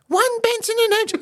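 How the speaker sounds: put-on very high child's voice; wee boy Keenan. Boy asking the shopkeeper for cigarettes, squeaky and high. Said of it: "a wee boy Keenan comes in".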